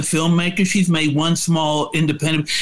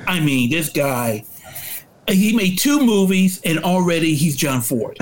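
normal talking voice

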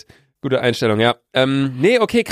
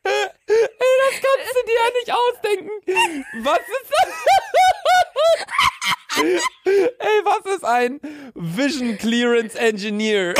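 high-pitched giggling through words; can barely speak for laughing; laughing fit; slower than usual. Real, not acted. Reading silly job title, cracking up.

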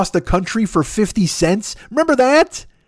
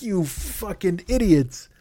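slow contemptuous insult; 6 dB quieter, slower than usual. Real, not acted. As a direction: spell out the contempt slowly and softly. Scolding parents.

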